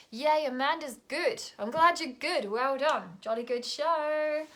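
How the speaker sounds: bouncy, loud well-done; delighted cheering. Real, not acted. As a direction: cheer them on heartily. Cheering a viewer's good news.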